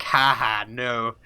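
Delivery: mock sarcastic laugh and refusal. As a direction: imitate a sarcastic brush-off. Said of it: "really sarcastically".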